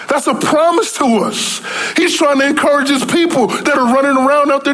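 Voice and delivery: high, pressing preacher's voice; preaching at full force. Acted. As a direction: preach it up, voicing the hurting believers. Preacher voicing the desperate believers.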